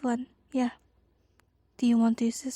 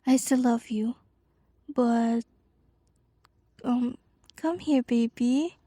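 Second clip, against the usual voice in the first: ordinary talk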